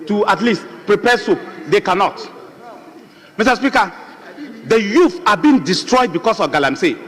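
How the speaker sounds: cool down and speak like an MP; calm measured speech. Real, not acted. MP pleads over Galamsey damage to the House, calm and subdued. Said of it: "let me calm down and speak like an M P".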